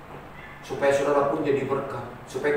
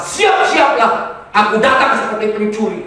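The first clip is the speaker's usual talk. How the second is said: raised, commanding scripture warning; voice of God; 7 semitones higher, 6 dB louder than usual. Acted. Preacher quoting God's warning to the congregation, proclaiming voice of God. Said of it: "Tapi kata Tuhan apa?".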